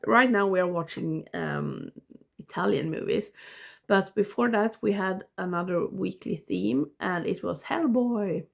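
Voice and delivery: normal talking voice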